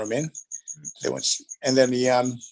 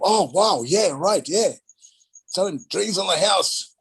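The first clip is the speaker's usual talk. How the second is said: mock impressed; 8 semitones higher, 4 dB louder, slower than usual. Club manager impressed.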